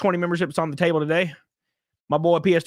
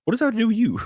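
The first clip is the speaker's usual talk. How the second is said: retold disbelief; 8 semitones higher, faster than usual. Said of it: "And I'm like".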